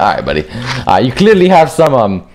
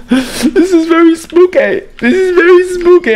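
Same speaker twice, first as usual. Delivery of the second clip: mock spooked. Real, not acted. Gamer spooked by the dark map, high squeaky voice.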